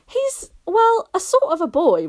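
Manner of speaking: put-on a child recounting a bump; Barney voice. A narrator's reading. Barney hesitating.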